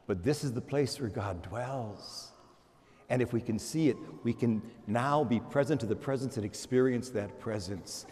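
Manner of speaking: soft, low reverent words; quiet contemplative preaching; hushed reflection. Acted. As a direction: lean in and speak tenderly.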